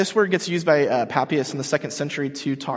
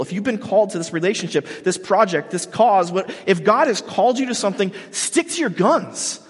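louder, higher, driving home the promise; preaching at full force; 6 semitones higher, 3 dB louder than usual. Acted. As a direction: stretch out the payoff like a revival preacher.

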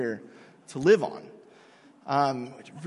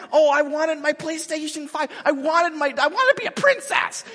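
high, louder whiny voice, rising further on princess; mock whining; 10 semitones higher, 6 dB louder than usual. Mocking spoiled people in a high whiny voice. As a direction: whine like a spoiled child. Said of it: "you take one little thing".

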